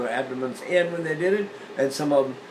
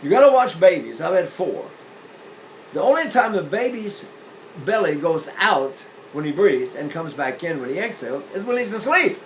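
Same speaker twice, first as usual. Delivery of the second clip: ordinary talk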